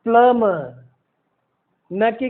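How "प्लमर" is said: "प्लम्बर" as plum-uh; no b in the middle of "प्लम्बर"; silent B. Shown as a demonstration. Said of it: "middle में भी उच्चारण इसका जो है सो silent होगा".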